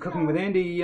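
loud, bright TV cooking host; show host announcement. Acted. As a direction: announce the show title like a TV chef.

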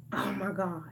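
breathless whispered oh my God; hushed in disbelief; shocked whisper. Real, not acted. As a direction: let it slip out as a stunned whisper. Shocked aside about the story.

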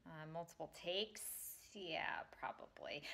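muttering to self. Real, not acted. Muttering setup before recording.

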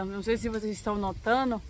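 usual speaking voice

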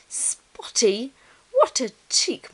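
high, drawn-out offended disbelief; indignant mutter, voiced out loud. A narrator's reading. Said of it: "muttered Ruby".

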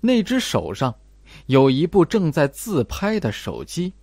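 ordinary delivery

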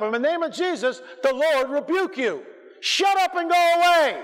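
a rising commanding rebuke, ending in a high, loud shout. Shown as a demonstration. Preacher showing the congregation how to rebuke a spirit, loud and commanding. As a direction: cast the thing out, firmly and with rising force. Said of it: "No one's standing up".

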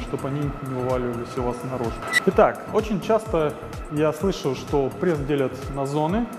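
ordinary delivery

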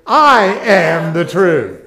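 slow, loud, ringing declaration; loud proclamation. Shown as a demonstration. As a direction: call it out for the room. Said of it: "you're gonna say".

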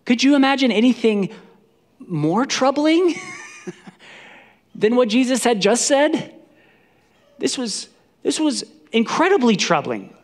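high, loud disbelief; incredulous. Shown as a demonstration. Preacher stressing how troubling Jesus' words were, high and incredulous. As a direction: exclaim it, astonished.

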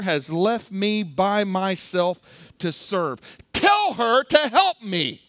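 high, loud, slow pleading outburst, Martha fed up with her sister; angry pleading Martha. Retold or imitated. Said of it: "This is an angry woman".

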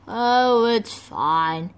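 ordinary delivery

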